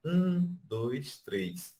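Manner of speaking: counting with a high first beat. Shown as a demonstration. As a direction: count it out, pitch up on um then drop. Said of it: "Ela coloca assim".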